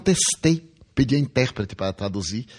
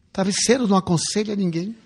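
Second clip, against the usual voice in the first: laughing; 6 semitones higher, 4 dB louder than usual. Real, not acted. Jokes about the pillow advice.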